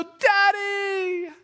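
put-on high, loud, drawn-out child's squeal; excited little daughters. Father imitating his girls greeting him, excited shout. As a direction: shout it like a kid running to a parent. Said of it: "having them run and just go".

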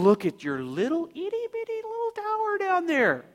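high-pitched baby-talk mockery; teasing, sing-song high condescension; mocking God. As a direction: coo down at something tiny. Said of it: "see God mocking them".

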